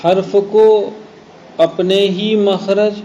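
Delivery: slow, deliberate dictation in separated phrases; definition for memorizing. Shown as a demonstration. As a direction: dictate it phrase by phrase for students to learn. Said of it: "تعریف خوب یاد کریں". (تعریف ٹھہر ٹھہر کر لکھوانا)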